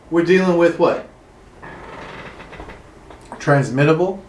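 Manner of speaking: ordinary delivery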